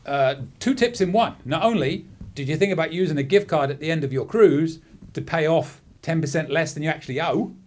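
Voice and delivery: normal talking voice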